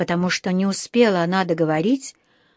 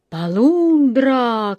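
drawn-out, higher call; shouted alarm; 4 semitones higher, 3 dB louder, much slower than usual. A narrator's reading. Boy rushing to separate doctor and patient, shouting. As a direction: holler it like a ship's warning. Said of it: "крикнул Джонатан".

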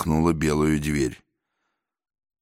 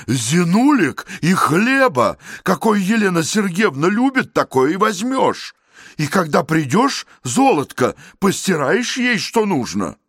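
calling out; 12 semitones higher, 4 dB louder than usual. Acted. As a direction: shout orders down the corridor. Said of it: "снова крикнул в коридор".

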